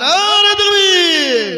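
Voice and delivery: loud, high, very drawn-out cry; slogan shout. Real, not acted. Preacher closing the donor's prayer with takbir, long shout. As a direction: stretch the takbir out at full voice.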